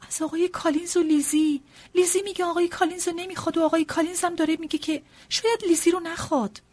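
high, loud, rapid explanation; flustered wife pressing her case; agitated wife. Acted.

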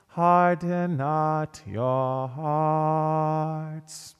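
ordinary delivery

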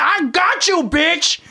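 high-pitched mock yell; imitated yell. Quoting Barry yelling on the dance floor, mock yell. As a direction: holler it high, imitating the movie guy. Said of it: "you yell like him".

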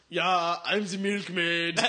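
slow, loud manly German milkmaid. Retold or imitated. Classmate walking in as the milkmaid, very manly voice. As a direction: draw it out in a mock manly voice. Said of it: "In a very manly voice".

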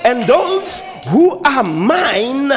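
high preacher voice with long-held words; impassioned preaching. Acted. Preacher voicing the shepherd's words to congregation, impassioned preaching. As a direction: proclaim it, stretching "know" and "mine".

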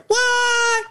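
very high, crying what; Aziz Ansari-like squeal. Retold or imitated. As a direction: mimic a comedian's high squeal. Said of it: "sounds like Aziz Ansari".

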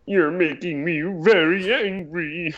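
a hint of a cartoon Martian; lightly voiced slow cartoon alien; Marvin the Martian. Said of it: "Marvin the Martian voice".

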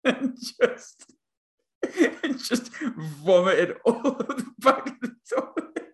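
high-pitched, helpless laughter while talking; wheezing with laughter mid-story; laughing through the story. Real, not acted. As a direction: barely get the words out for laughing. Telling story while laughing hard.

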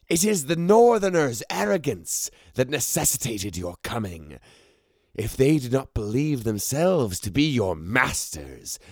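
high, strange haughty voice; scornful sorceress. A narrator's reading. Ceramis lecturing her own contingent, high and scornful. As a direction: sneer down at them from a height.

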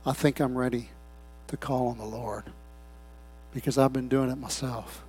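very soft, low, halting confession; hushed surrender. Acted. Worn-out sinner ready to call on the Lord, hushed. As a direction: barely voice it, pausing between phrases. Voices a penitent ready to return.

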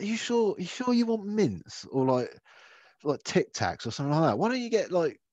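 high, disbelieving questioning; incredulous. Retold or imitated. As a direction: pitch it up in disbelief. Mum questioning kids' sweet choice.

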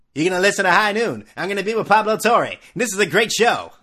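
loud, high-pitched mock sports-radio voice; hammed-up radio personality at full volume; Bomani Jones. Host mocking a broadcaster's show plug, exaggerated radio voice. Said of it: "Like the Bomani Jones voice?".

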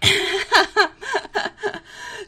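high burst of laughter; loud laugh. Real, not acted. Laughs at rom-com remark.

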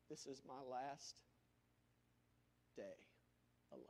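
imagining being stranded with family and thinking it's the end; hushed despair. Acted. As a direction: let the thought fade away. Imagining last day.

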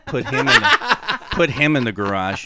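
laughing through the words. Real, not acted. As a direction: giggle out the words. Laughing at the cat voice.

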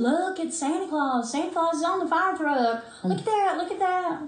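put-on high, thrilled little kid pointing at Santa; excited child. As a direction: squeal it like a small child spotting Santa. Child seeing Santa on truck.

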